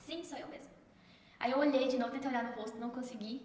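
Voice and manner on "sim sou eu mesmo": very soft, hushed reply; quoting Jesus's answer. Retold or imitated. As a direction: drop right down to a gentle, quiet confirmation. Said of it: "Bem assim".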